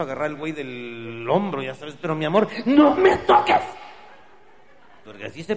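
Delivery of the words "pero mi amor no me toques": high, loud don't-touch-me cry; angry falsetto. Acted. Girlfriend pushing the boyfriend away, high and angry. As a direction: pull away and snap at him. Girl refusing his touch.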